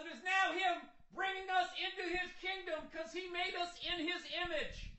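very high, strained mock-preacher voice; a red voter spins the flood as God's will, hammed up; preachy red voter. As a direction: ham up a pious spin, then fade. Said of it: "They're gonna".

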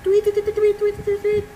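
high chirpy tweeting like a hummingbird; playful high bird imitation; bird tweets. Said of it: "Ooh, hummingbird".

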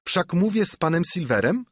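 put-on young Jim asking carefully; lightly voiced cabin boy; timid boy asking. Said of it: "zapytałem".

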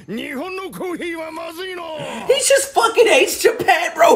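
laughing through the words in disbelief; high, laughing outburst at the screen. Real, not acted. Laughing reaction to the show.

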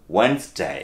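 "ওয়েন্সডে" joined up as wens-day; silent D. Shown as a demonstration. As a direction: say "ওয়েন্সডে" in two syllables, no first D. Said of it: "ডিটাকে বাদ দিয়ে". ("ওয়েন্সডে" একটানা, ড বাদ দিয়ে)

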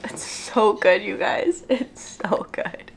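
slow, soft whispered praise; slow hushed whisper of delight. Real, not acted. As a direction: whisper it like a secret treat. Savouring the dip, hushed.

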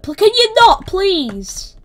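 high, loud protest; exasperated plea. Real, not acted. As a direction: protest loudly as you come under fire. Pleading while being shot at.

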